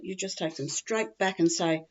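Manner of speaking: usual speaking voice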